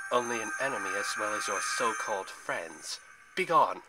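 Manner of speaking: cold disdain rising to a loud command; cold dismissal. A narrator's reading. First sister banishes Zoe and friends, cold then loud dismissal. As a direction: banish them, building to a forceful last word. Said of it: "the first sister said coldly".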